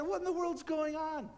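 high, baffled "what in the world"; a bewildered barber exclaims. Acted. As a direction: throw up your hands in confusion.

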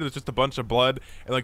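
ordinary talk

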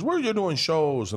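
high-pitched, friendly imitated question; Conor McGregor. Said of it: "he's the sweetest guy".

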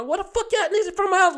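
high, fast, laughing rant; ranting at the parked truck, laughing; laughing mock outrage. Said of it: "I'm like".

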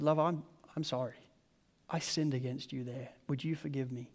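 low, soft, sincere asking; tender apology. Retold or imitated. Dad apologising to his child, tender apology.

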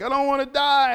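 imitating a coward's high, loud wail; retold crying. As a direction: wail it as a pretend coward begging. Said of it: "he starts to cry".

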